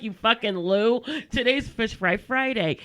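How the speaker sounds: loud, slow, spelling it out; slowly and loudly, as if to a dimwit; exasperated scolding. Retold or imitated. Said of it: "she's like".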